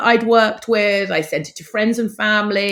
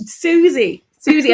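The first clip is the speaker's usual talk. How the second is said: delighted; 8 semitones higher, 5 dB louder, slower than usual. Real, not acted. Joyfully repeats the bus's name.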